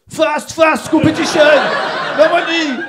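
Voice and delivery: put-on very loud, high, broken English with Marseille accent. Passenger answering the cab driver, shouted Marseille-accented English. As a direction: yell each English word in a Marseille accent. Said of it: "c'est des Marseillais, ils font pas de phrases".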